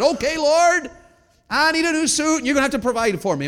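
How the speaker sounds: high, shouted appeal to God; loud, acted-out prayer of need; demanding prayer. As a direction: call out boldly to the Lord.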